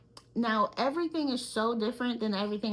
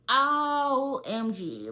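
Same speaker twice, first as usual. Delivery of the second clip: exasperated; 3 semitones higher, much slower than usual. Retold or imitated. Recalling third epidural pain.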